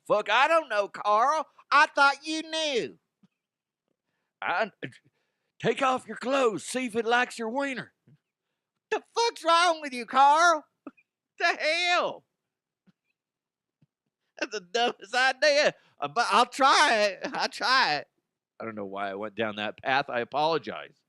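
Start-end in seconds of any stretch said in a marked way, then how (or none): 0.1-3.0 bumbling catcher. Retold or imitated. Bumbling emu catchers arguing. 4.4-7.9 bumbling catcher. Retold or imitated. Bumbling emu catcher idea. 8.9-18.1 bumbling catcher. Retold or imitated. Other catcher reacting.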